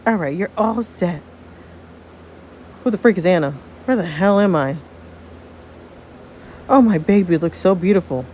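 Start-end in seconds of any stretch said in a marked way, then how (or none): none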